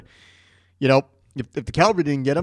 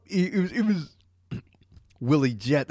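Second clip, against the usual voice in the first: ordinary talk, nothing marked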